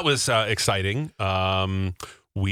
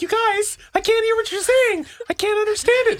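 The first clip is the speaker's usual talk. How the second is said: falsetto mock of a hard-of-hearing friend; teasing imitation, very high; mock old lady; 4 dB louder than usual. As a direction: squeak out her confusion up high. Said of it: "She's like".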